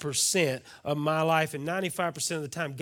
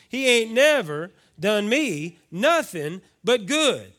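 preached lyric; 7 semitones higher, 10 dB louder, slower than usual. Acted. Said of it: "I know it's bad English".

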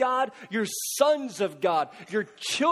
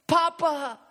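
higher, pleading cry for help, held short of a scream; desperate cry; 7 semitones higher, slower than usual. Shown as a demonstration. Preacher acting a suffering believer's prayer, desperate plea. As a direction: cry out like a frightened child. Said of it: "It's a scream sometimes of desperation".

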